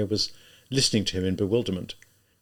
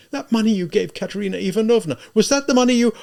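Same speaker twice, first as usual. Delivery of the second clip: high, loud, horrified questioning; distraught woman, high and urgent; sudden cry; 12 semitones higher, 7 dB louder than usual. A narrator's reading. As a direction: cry it out as the awful thought strikes. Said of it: "she cried suddenly".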